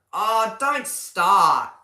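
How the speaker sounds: loud, drawn-out don't start; exasperated. Real, not acted. Streamer begging a viewer to drop a sore topic, loud and drawn out. Viewer raises Red Bull going to Ford.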